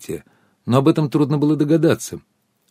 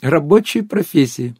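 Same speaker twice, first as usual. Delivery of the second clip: higher, slow, drawn-out echo; squealed disbelief; 5 semitones higher than usual. A narrator's reading. Guest repeats the absurd phrase, slow squealed disbelief. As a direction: savour each word in mock amazement. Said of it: "взвизгнула Анюта".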